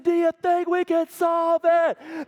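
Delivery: slow, drawn-out, loud and high; hype-man challenge. Acted. Teacher hyping the class, a slow loud drawn-out challenge. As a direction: stretch it out to rile up the kids. Hyping kids before problem.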